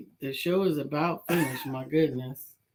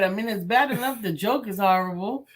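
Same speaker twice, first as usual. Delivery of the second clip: ordinary talk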